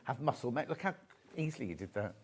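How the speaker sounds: normal talking voice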